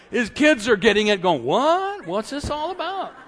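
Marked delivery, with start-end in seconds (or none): 1.4-3.1 high, puzzled questioning; baffled. Acted. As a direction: react in comic bewilderment. Acted kids baffled by text.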